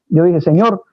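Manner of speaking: usual speaking voice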